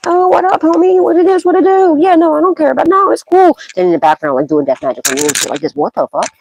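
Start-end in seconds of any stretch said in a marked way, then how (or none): 0.1-3.6 much higher, breezy two-faced buddy voice; fake friendly greeter. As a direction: play the phony friend grinning in their face. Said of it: "will be smiling in your face".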